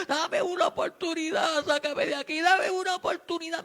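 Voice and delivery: high, loud desperate cry to God; shouted prayer for mercy; shouting plea. Retold or imitated. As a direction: yell out begging for a chance. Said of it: "le gritaba a Dios".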